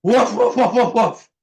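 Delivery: mother mouse barking like a dog, loud and high; loud barking woof. Acted. Mother mouse scaring off the cat with a loud bark. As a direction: bark it out as loud as you can muster. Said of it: "in as loud a voice as she possibly could muster".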